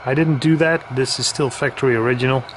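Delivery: normal talking voice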